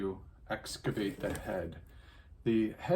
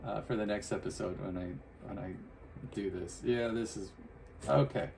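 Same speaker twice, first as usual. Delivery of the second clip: usual speaking voice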